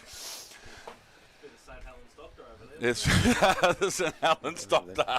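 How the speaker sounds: laughing through the words; giggling while talking. Real, not acted. Commentator cracks up at joke.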